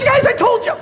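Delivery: a coworker pleading with the group; imitating Michael. Michael telling the others he warned them, high-pitched. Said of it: "he goes".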